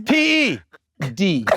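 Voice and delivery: ordinary talk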